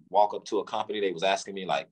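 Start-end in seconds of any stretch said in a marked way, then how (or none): none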